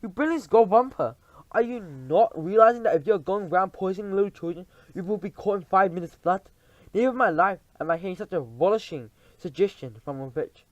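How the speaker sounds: loud, high scolding rant; raging tirade. Acted. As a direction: berate her with fury. Witch queen berating culprit.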